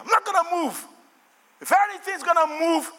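high, emphatic refusal; defiant declaration. Acted. Believer refusing to yield to enemy, high defiant preaching. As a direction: declare it like a believer standing firm.

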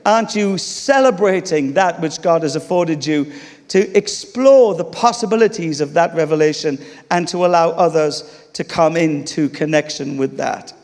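normal talking voice

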